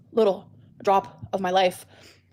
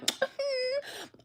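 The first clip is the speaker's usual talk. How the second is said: mock cry; 9 dB quieter than usual. Imagining being a let-down fan.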